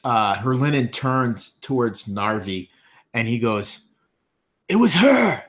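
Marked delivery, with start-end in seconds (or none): 4.7-5.4 high, loud crazed cry; crazed accusation. Acted. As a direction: accuse her in a frenzy. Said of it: "he still got this crazed look".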